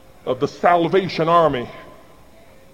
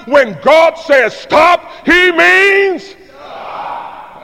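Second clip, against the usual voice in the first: slow, stretched shout, much higher; booming call; 9 semitones higher, 4 dB louder, much slower than usual. Shown as a demonstration. Preacher leading the congregation in a shouted refrain, booming. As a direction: boom out stop like a command. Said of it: "That's a little better".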